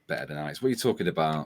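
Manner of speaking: low, very soft muttering; quiet, low grumble of disbelief; grumbling under breath. Real, not acted. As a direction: mutter it to yourself, miffed. Grumbles over a chat comment.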